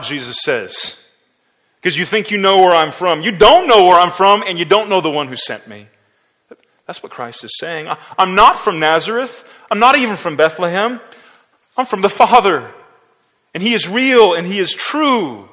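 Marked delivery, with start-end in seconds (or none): 1.8-5.9 loud, high, building rebuke; forceful rebuke. Acted. Jesus rebukes the crowd, loud and high. 7.9-15.5 loud, high proclamation; fervent declaration. Acted. Jesus proclaims his origin to the crowd, loud.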